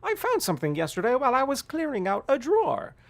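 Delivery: put-on high, loud scolding old mother; shrill disapproving mom voice; old lady voice. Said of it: "old lady voice".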